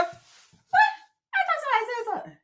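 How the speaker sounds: high, fast put-on diva voice; mock sassy voice. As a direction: snap back in a high mocking voice. Same mock reply again.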